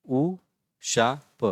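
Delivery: "उशाप" very slowly in three separate pieces; syllable by syllable. Shown as a demonstration. Teacher sounding out "उशाप", syllable by syllable. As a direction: break "उशाप" up with pauses between syllables.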